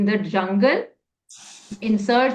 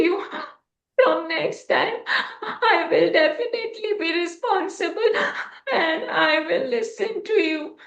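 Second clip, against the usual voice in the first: put-on much higher, crying child voice; tearful apology; 6 semitones higher than usual. A narrator's reading. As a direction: sob out the sorry.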